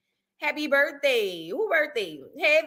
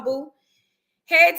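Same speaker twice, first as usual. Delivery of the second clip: ordinary talk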